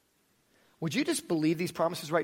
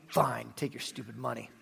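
grudging; 8 semitones lower, 10 dB quieter than usual. Acted. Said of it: "not grudgingly".

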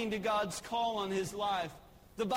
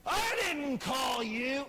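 more grumpy and upset; 2 semitones higher, slower than usual. Acted. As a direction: bark it like an annoyed old priest. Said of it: "more grumpy, more upset".

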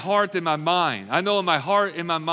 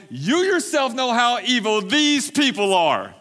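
Aaron pleading, pitched up; placating whine, raised; Aaron's excuse, high pitched; 8 semitones higher, 6 dB louder than usual. A narrator's reading. Aaron voiced, read scripture.

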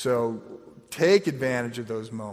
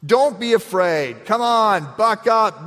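loud, high, brusque buck-up; mock tough-love command; gruff pep talk; 8 semitones higher, 9 dB louder, slower than usual. Shown as a demonstration. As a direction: bark it like a coach toughening someone. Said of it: "This is not".

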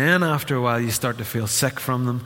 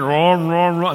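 loud, rough mock engine growl; imitated engine roar; 4 semitones higher, 5 dB louder, slower than usual. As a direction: sputter it like a struggling motor. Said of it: "it started the next morning and went".

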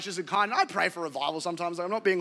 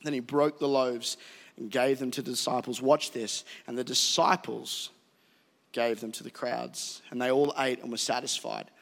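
scripture reading; 5 semitones lower than usual. Acted. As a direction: read the passage deliberately. Reads scripture slowly.

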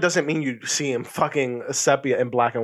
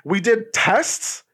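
higher-pitched mock protest; retold indignant denial; 6 semitones higher, 3 dB louder than usual. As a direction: imitate an incredulous friend, stretching the last word. Friend denies being colorblind, retold.

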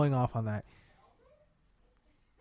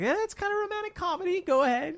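dismissive; 5 dB louder than usual. Retold or imitated. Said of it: "I was like".

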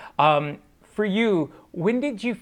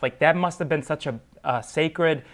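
ordinary talk

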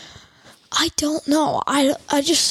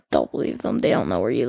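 reassuring whisper; faster than usual. Retold or imitated. Comforting bullied kid.

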